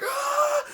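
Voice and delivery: high mock scream; acted high shriek of panic; panicked scream. Bidder torn over waiting, mock panicked scream.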